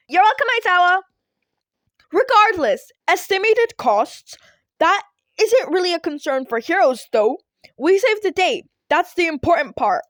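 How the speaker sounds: high and loud, hammed-up hero; booming hero voice. A narrator's reading. All Might brushing off repair costs, booming proudly. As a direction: boom it out like a proud cartoon hero.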